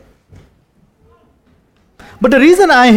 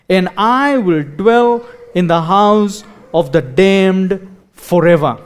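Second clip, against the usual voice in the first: solemn scripture reading. Acted. Reads parody psalm aloud solemnly.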